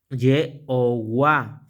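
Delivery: "Y o Wa" said very slowly, each syllable pulled apart; sílabas separadas. Shown as a demonstration. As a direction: stretch out "Y o Wa" to show the two parts. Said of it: "Voy a dividirla en dos partes". (decir «Y o Wa» muy despacio, separando cada sílaba)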